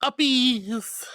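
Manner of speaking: high, drawn-out sing-out; playful cheer. Pretend. Streamer giving viewers uppies, playful high cheer. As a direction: call it out like hoisting a toddler up high.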